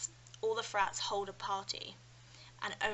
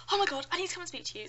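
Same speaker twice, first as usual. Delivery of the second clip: higher, louder, fast rush of excitement; excited gush; 8 semitones higher, 6 dB louder, much faster than usual. Retold or imitated. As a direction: gush like a thrilled new friend. Australian girl excited.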